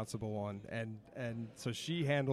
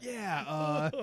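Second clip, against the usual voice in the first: laughing; 5 semitones higher, 5 dB louder than usual. Retold or imitated. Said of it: "quote after wife".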